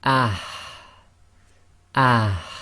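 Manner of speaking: ah with air forced from the mid throat out the mouth; forceful ha. Shown as a demonstration. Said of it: "it will take the sound of".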